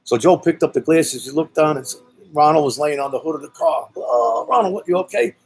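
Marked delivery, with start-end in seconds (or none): 3.9-5.3 a higher, urgent call down through the hole; worried call to a friend. Retold or imitated. Friend calling down to Ronald in the rain, higher anxious call.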